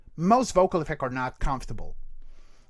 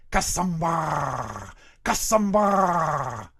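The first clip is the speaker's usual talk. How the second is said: slow rolled alien call; uvula trill voice; 5 dB louder, much slower than usual. Shown as a demonstration. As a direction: roll it through the uvula, slowly. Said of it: "uvula to actually do this".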